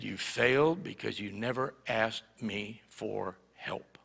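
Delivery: soft, slow hushed whisper; a gentle inner voice, hushed; hushed voice of God. Retold or imitated. The Holy Spirit answering the boy, slow hushed whisper. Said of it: "I heard the Holy Spirit".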